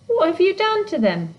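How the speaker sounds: high, rising anger. A narrator's reading. Girl confronting the hidden creature, high and indignant. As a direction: demand it, getting angry. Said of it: "her anger rising".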